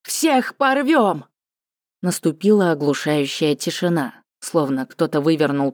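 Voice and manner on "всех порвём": high, slow roaring battle cry; war cry. Acted. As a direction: roar it like warriors. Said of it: "заорали во всю мощь своих лёгких".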